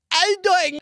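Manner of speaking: absurdly high, loud put-on voice; strangest voice. Chiseled six-foot-five guy greeting a woman, absurdly strange high voice. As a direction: pitch it way up and push it out, cartoonishly odd. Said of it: "It was the most strangest voice".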